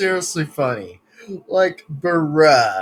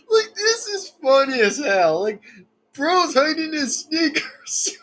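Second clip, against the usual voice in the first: laughing; 7 semitones higher than usual. Real, not acted. Laughing at the clip.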